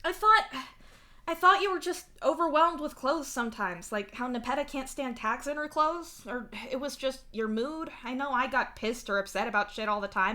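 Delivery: protesting. A narrator's reading. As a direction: start loud and defensive, then ease off. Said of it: "you protest".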